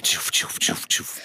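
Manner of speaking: tief gehauchtes Tschuf als Soundeffekt; Strike-Geräusch. Pretend. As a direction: stimmlos mit dem Mund gemachter Sound. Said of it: "Ich mach's das heute einfach mit dem Mund". (tief gehauchtes Strike-Geräusch)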